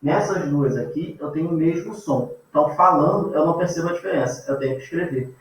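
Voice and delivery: ordinary talk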